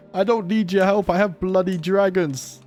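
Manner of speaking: usual speaking voice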